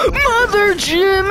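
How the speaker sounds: high, loud, slowed exclamation; startled exclamation. Real, not acted. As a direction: blurt it out in shock.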